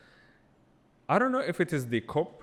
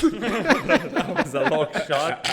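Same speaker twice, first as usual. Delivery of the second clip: high, loud, drawn out through laughter; laughing; 8 semitones higher, 9 dB louder, much slower than usual. Real, not acted. Podcast guest teasing the host mid-laugh, laughing. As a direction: crack up while saying it. Joking amid shared laughter.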